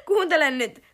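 laughing loud call to listen. Real, not acted. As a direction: burst out laughing while calling. (nauraen ja kovaa)

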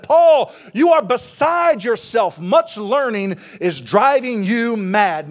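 high, emphatic preacher's yell, drawn out; acted furious rebuke, pitched high; yelling scornfully. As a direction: call out the name, then scold slowly and forcefully. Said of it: "He was yelling at him".

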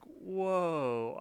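long, high drawn-out whoa; mock amazement. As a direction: imitate cartoon friends taken aback. Retold reaction to bald spot.

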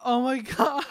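laughing. Real, not acted. Viewer amused by the movie, laughing through the words. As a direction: crack up halfway through the words.

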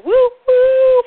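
high, loud choo-choo woo; imitated steam whistle, leaping way up; train whistle. Shown as a demonstration. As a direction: hoot like a toy train, second one held long. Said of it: "for choo-choo".

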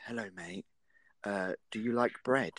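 soft, low whispered creep; creepy whisper. Retold or imitated. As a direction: breathe it like a creepy stranger. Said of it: "the first thing that came into his mouth is".

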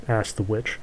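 ordinary delivery, nothing marked

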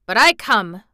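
put-on loud, stubborn old gardener; gruff, obstinate Yorkshireman; grumpy obstinacy. A narrator's reading. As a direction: dig in grumpily. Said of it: "with grumpy obstinacy".

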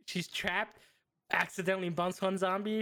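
ordinary talk, nothing marked

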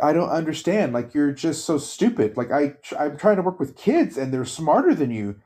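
retold exasperation. Frustrated trainee lecturing her boss, exasperated. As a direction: imitate a fed-up employee pleading for sense. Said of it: "she was so frustrated".

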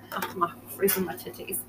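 hushed aside about the cold; whispering. Real, not acted. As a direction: whisper it as a private complaint. Cold from air conditioner.

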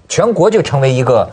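usual speaking voice

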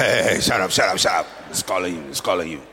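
mock crowd shoving him along; a hint of bossy bystanders hurrying the beggar; imitating rough hushing. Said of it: "I can almost imagine that".

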